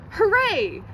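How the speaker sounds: high, loud cheer; shouted hooray. Acted. Child cheering for pocket money day, loud cheer. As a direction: cheer it out like a happy kid. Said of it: "Each Saturday I shout".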